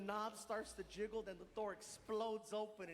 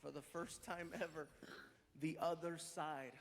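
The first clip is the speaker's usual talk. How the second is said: announcer intro; 6 semitones lower than usual. Acted. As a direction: present the big reveal to the crowd.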